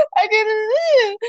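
very slow, high sobbing words; crying. Retold or imitated. Girl crying to laughing parents, high and slow sobbing. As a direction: sob it through a blocked mouth. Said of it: "me puse a llorar".

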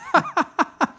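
loud high laugh; real burst of loud, high laughter. Real, not acted. Co-host admits throwing him under the bus, laughing.

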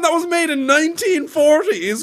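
high, loud, slow disbelief; incredulous. Real, not acted. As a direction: stretch out the disbelief. Disbelief at Pinocchio remakes.

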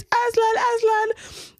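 high-pitched searching call. Retold or imitated. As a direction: re-enact hollering the cat's name. Calling out for lost cat.

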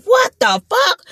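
high, drawn-out "What the fuck?"; shocked cry. Retold or imitated. Hearing gunshots from the car, a high slow shocked cry. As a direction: stretch it out in disbelief.

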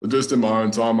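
normal talking voice

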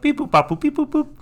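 very high beeping phone noises; toy robot voice. Retold or imitated. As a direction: chirp the beeps like a toy robot. Imitating a phone call coming in.

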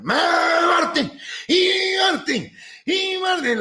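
high, drawn-out shouted name; mock pastor call. Pastor calling Martín again, high drawn-out. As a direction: keep calling him out.

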